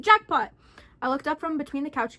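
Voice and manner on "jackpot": sudden loud triumphant shout. Acted. Man finds the safe, shouting in triumph. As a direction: yell it out in triumph. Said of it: "he shouted".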